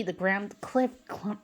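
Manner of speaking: plain reading voice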